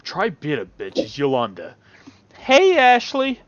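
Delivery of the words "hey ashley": high bright greeting voice; Yolanda's voice. Acted.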